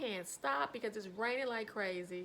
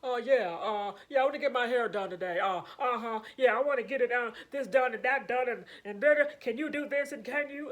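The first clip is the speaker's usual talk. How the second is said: loud, bossy customer voice, much louder; intimidating client; 9 dB louder, faster than usual. Retold or imitated. Imitating the scary walk-in client demanding a hairdo, loud and bossy. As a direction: boom it out like a pushy walk-in client. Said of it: "She's like".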